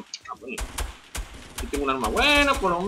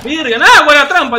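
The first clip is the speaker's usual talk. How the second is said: loud high cheering; thrilled cheer; 8 semitones higher, 12 dB louder than usual. Real, not acted. Streamer cheers his trap catching an enemy.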